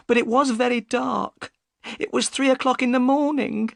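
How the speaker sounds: high, worried woman's voice; the boatman's anxious wife; high worried voice of Mrs Smith; upset wife. Acted.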